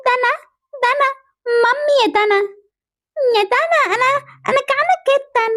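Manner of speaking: put-on high, tearful little-child plea; whining. Little sister to big sister, hungry, whining. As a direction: whine pitifully for food.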